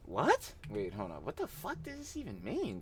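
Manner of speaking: high, quiet, incredulous; can't believe what he just heard; stunned disbelief. Real, not acted. Streamer reacting to a clip, high incredulous disbelief.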